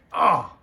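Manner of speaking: sudden loud cry of frustration; frustrated yell. Real, not acted. Fan ranting about defense, a sudden frustrated yell. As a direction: let a real burst of anger out. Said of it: "I got fired up".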